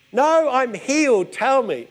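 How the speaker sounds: a touch of a healed woman's defiance; insistent. Retold or imitated. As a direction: declare it like a claim.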